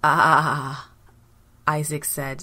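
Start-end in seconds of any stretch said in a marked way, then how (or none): 0.0-0.9 long, louder sigh of satisfaction; satisfied "ah". A narrator's reading. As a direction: let out a drawn-out contented "ah" as an old man. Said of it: "satisfied at last".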